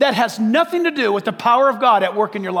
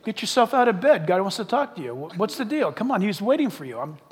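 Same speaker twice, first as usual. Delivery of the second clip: soft, playful voice of a little dog; talking dog voice; 7 dB quieter than usual. Pretend. Preacher voicing his little dog waking him, soft playful dog voice. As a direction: play the pet dog nudging its owner awake. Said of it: "if your poodle said that to you".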